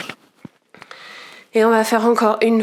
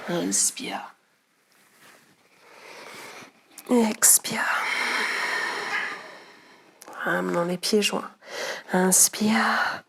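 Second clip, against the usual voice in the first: soft, near-whispered breath counts while moving; breathless whispered cues; 10 dB quieter, much slower than usual. Real, not acted. Yoga teacher doing the poses herself, out of breath, whispering breath cues. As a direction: barely voice the inspire and expire, out of breath. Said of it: "Je suis un petit peu essoufflée".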